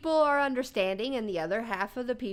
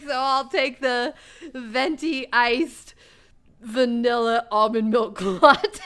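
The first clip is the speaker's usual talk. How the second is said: laughing quote; 6 dB louder than usual. Retold or imitated. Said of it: "So he's like".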